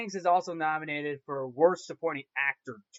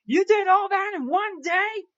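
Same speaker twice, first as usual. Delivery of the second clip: high, loud astonished old man, hammed up; imitating Geppetto; 8 dB louder than usual. Geppetto amazed at Pinocchio's adventures, shrill mock disbelief. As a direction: squeal it out like a stunned old woodcarver. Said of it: "then Geppetto was like".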